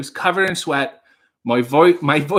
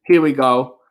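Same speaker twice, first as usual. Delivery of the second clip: normal talking voice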